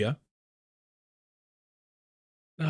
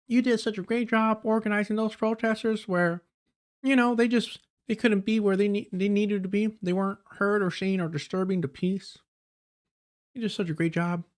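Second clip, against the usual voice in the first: mocking Southern accent, higher and softer; 5 semitones higher, 6 dB quieter than usual. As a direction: parrot her in a Southern accent. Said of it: "he gave her a Southern accent".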